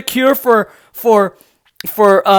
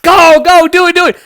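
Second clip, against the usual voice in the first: taunting cheer; 9 semitones higher, 6 dB louder than usual. Acted. Taunting the pundit.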